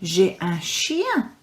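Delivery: "J'ai un chien" slow and clear, French nasal; French word said slowly. Shown as a demonstration. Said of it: "when I said".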